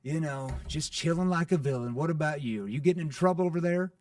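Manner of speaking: loud, teasing and cheeky; playful tone. Shown as a demonstration. Salesman answering a prospect's how-are-you, playfully. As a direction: disarm the prospect with a joke. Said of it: "it's a playful tone".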